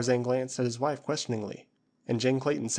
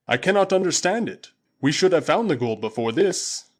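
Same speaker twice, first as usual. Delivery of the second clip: booming Waziri warrior; 5 semitones higher, 7 dB louder than usual. Acted. Usula answering his master Tarzan, loud and proud. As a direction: call it out like a loyal tribesman.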